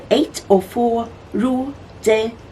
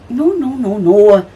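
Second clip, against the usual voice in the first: insistent interruption; 6 dB louder than usual. A narrator's reading.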